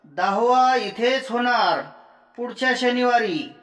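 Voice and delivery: high, projected proclamation; loud announcement. A narrator's reading. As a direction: call it out so the whole gathering hears. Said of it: "पुन्हा मोठ्याने सांगू लागला".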